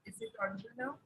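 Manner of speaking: faint, distant mic voice; speaking far from the microphone; barely audible. Real, not acted. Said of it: "Yes, a bit, ma'am".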